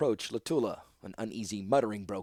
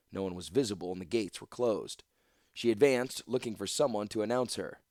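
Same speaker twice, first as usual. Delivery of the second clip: usual speaking voice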